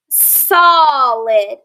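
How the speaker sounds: "Solid" as SAH-lid, drawn out; short O in "Solid", first syllable stretched; correct pronunciation. Shown as a demonstration. Teacher modelling the word for pupils, short-O "Solid". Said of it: "How do we correctly pronounce it?".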